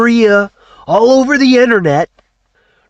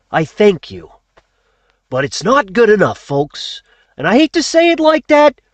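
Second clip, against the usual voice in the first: ordinary talk, nothing marked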